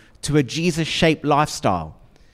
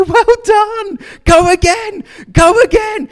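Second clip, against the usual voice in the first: much higher, louder, bright cheering; cheery encouragement; 7 dB louder, slower than usual. Pretend. Parent cheering a child learning to ride, high and excited. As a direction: cheer the kid back onto the bike. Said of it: "you pick them up and you say".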